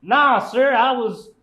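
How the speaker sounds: high, loud, drawn-out cowboy; cowboy boots voice. Acted. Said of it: "That's a weird accent".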